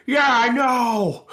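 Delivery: high, drawn-out goofy yell. Retold or imitated. Patient answers doctor about dying, hammed-up fat guy voice. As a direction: stretch it out loudly in a caricatured big-guy voice. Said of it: "not to make the stereotypical fat guy voice".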